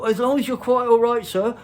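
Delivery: high, loud, flustered deference; servile old man. Retold or imitated. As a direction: fawn like a bowing, scraping old fellow. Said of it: "He's all bowing and scraping".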